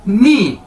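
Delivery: नी demonstrated once, cleanly; Chinese nǐ. Shown as a demonstration. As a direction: model नी for the students. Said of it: "आपने इसे कैसे बोलना है".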